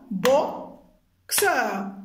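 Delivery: BOXEUR as bo, pause, xeur; syllable by syllable. Shown as a demonstration. Teacher tapping syllables for pupils, split apart. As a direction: firmly separate the two beats of boxeur. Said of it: "tapez les syllabes".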